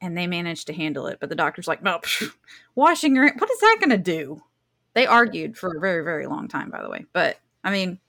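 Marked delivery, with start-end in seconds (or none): 1.8-4.4 mock-scoffing, pitched up on the retort; dismissive doctor. Host mimics doctors rejecting handwashing, dismissive and incredulous. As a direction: scoff like a know-it-all doctor.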